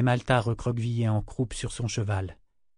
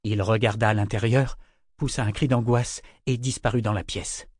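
usual speaking voice

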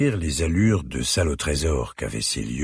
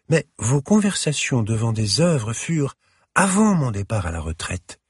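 put-on elegant older lady; 7 semitones higher than usual. A narrator's reading. Curator apologises.

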